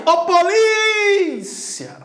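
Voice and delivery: high, stretched call on polícia; long drawn-out call. Shown as a demonstration. Teacher calling to future police officers, stretched call. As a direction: holler polícia, holding the stressed vowel. Calls out example word, stretched.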